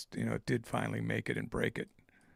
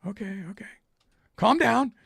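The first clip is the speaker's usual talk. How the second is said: high, loud mock indignation; exasperated retort; 12 semitones higher, 9 dB louder than usual. Answering the Swedish buyer's nagging, mock indignant. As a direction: push back, hammed-up annoyed.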